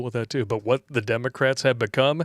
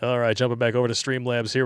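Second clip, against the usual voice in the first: ordinary talk, nothing marked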